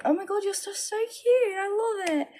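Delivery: put-on high, gushing little girl. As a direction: squeal like an excited five-year-old. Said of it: "they're just like".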